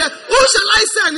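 loud, high, slow shouted call; calling out the line to be echoed back; congregation call. Shown as a demonstration. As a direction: shout it for them to repeat.

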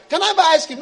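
high, loud begging; pleading believer. Acted. Believer begging God for the car, pleading.

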